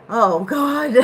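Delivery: shrieking with laughter; cracking up loudly; loud laughing fit. Real, not acted. Bursts out laughing.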